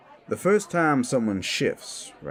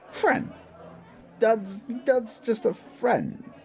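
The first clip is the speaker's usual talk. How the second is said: much higher, defensive squeak; flustered denial; 13 semitones higher, slower than usual. Acted. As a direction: insist in a high voice, stumbling over the start.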